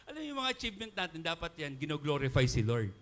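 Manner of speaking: ordinary talk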